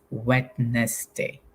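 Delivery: Wednesday said slowly as Wed-nes-day, every letter sounded; spelling pronunciation. Shown as a demonstration. Teacher to students, a memory trick: spelling Wednesday aloud. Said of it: "in your mind, spell it as".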